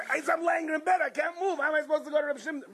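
high, pleading, rushing at the end; helpless protest. Acted. Patient protesting to the Yerushalmis, high helpless whine. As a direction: whine that it's impossible.

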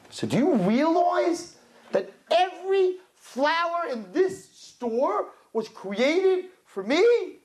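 high, slow, emphatic wonder; excited proclamation. Retold or imitated. Lecturing the women in the flower store, high excited proclamation. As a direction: stretch each phrase out as a high, rousing revelation.

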